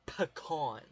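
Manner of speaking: "pecan" as a deliberate puh-KAHN; Southern pecan pronunciation. Shown as a demonstration. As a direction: stress the second syllable of "pecan", drawn-out ah. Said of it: "as a Southerner, we say".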